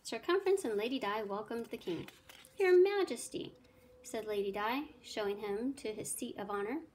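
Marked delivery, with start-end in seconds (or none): 2.6-3.5 high, loud, ceremonious address; grand welcome. A narrator's reading. Lady welcoming the king to his seat, grand greeting. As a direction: hail royalty with a flourish.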